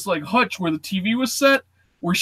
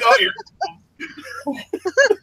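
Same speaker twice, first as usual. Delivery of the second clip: mock dread; 14 semitones higher, slower than usual. Waiting to be blamed.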